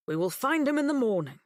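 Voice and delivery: lightly voiced dad, firm; a hint of a steady father; father's voice. A narrator's reading. Dad promising his daughter, mildly firm.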